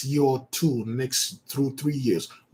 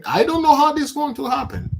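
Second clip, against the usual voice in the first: bewildered; 12 semitones higher, 4 dB louder than usual. Real, not acted. Sudden high exclaim.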